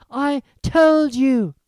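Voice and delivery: high, breathless and drawn out. A narrator's reading. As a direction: stretch the words, still winded. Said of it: "Hermione gasped".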